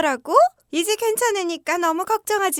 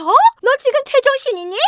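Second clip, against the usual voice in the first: scornful outrage; 5 semitones higher than usual. Acted. Mother-in-law berates daughter-in-law.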